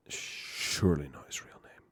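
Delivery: whispered aside. Real, not acted. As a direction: mutter it as an aside. Unvoiced whisper.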